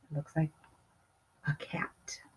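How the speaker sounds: hushed secret whisper. Real, not acted. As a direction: lean in and whisper the surprise. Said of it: "whispered hint to listeners".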